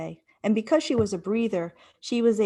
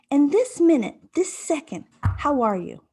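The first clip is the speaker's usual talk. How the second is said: self-coaching question; 7 semitones higher, 5 dB louder than usual. Retold or imitated. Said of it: "I said".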